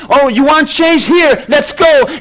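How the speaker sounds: Jesus voiced with a sudden shout on here; mock call. Jesus calling the complainer to follow him, shouted. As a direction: challenge them with a short yell.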